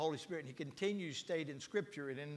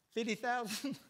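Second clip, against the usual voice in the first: higher, rising, disbelieving fifty thousand; incredulous repeat; 6 semitones higher than usual. Retold or imitated. Pastor at the conference asks, half in disbelief. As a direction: echo the figure as if it can't be.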